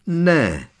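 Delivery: high, short yelped cry; startled yelp. A narrator's reading. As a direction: yelp it out in alarm. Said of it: "vyjekla Hermiona". (vyjeknout úlekem)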